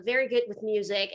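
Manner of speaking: ordinary talk, nothing marked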